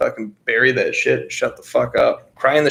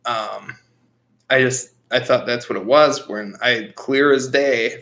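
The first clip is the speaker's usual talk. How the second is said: ordinary talk, nothing marked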